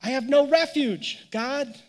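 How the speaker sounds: the hurting believer crying out to God in despair; mock despairing cry. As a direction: cry it out like you're cornered. Despairing cry quoted again.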